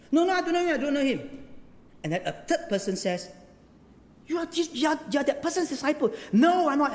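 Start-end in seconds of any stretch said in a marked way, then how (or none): 0.1-1.5 high-pitched, protesting mock denial; flustered denial. Peter denying Jesus to a bystander, flustered. 4.3-6.1 high, hurried mock accusation; insistent accuser. Third person accusing Peter, insistent. 6.3-6.9 high, louder mock protest; emphatic denial. Peter denying he is a disciple, emphatic.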